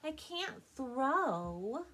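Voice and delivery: high, slow, hesitant little duck voice; timid little duckling. A narrator's reading. Elmer to Papa, high and hesitant. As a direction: stammer it out like a shy small duckling.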